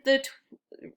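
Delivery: ordinary delivery, nothing marked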